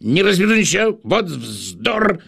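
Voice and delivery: quick curt voice. Acted. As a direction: snap it out fast and sharp. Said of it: "таким же голосом".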